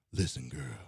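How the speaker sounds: deep, slow crooning lover voice; creepy seductive voice. Pretend. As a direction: croon it low like a smooth seducer. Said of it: "Why you gotta do the voice?".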